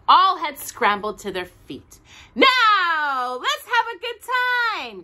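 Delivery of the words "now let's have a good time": high, loud soldier's call; rally the dolls like a tiny soldier; tin soldier's cry. A narrator's reading. Tin soldier calling the dolls to play, high and loud. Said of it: "cried the tin soldier".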